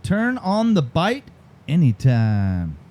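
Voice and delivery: slow tagline, split by a pause; deliberate, drawn-out slogan. Shown as a demonstration. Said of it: "Say it a little bit slower".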